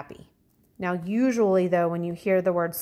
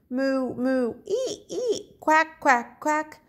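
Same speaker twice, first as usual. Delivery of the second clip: animal noises; 6 semitones higher, slower than usual. Acted. Teacher voicing all three animals cheering their escape. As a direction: run through the farm animal calls gleefully.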